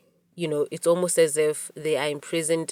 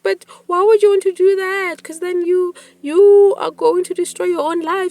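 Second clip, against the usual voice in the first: alarmed pleading; 13 semitones higher, 7 dB louder than usual. Retold or imitated. Retold alarm pleading with friend.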